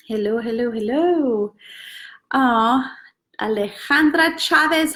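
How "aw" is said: ordinary delivery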